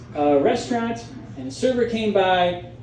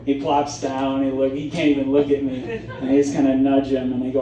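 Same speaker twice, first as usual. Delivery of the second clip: ordinary talk, nothing marked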